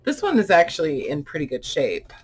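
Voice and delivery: ordinary talk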